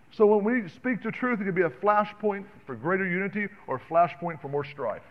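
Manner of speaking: full-force preaching. Acted. Preacher to the congregation, fiery full-force preaching. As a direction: drive each command home from the pulpit.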